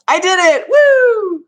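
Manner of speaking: high, loud whoop; triumphant cheer. Real, not acted. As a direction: whoop in celebration. Celebrates out loud.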